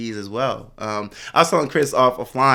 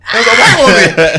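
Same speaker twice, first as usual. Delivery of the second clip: laughing while telling it; 10 semitones higher, 13 dB louder than usual. Real, not acted. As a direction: talk through real laughter. Laughing at the story.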